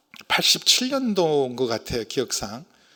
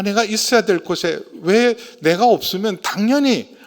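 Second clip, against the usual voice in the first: ordinary talk, nothing marked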